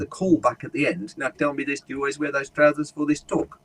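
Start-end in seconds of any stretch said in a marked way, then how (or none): 1.2-3.6 lightly comic South African questioner; funny South African accent. Retold or imitated. Comedian quoting the South African guy's callback, funny South African accent. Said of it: "there's the funny accent".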